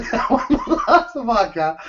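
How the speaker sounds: giggling through a mocking repeat; laughing mockery. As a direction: laugh while you mock the excuse.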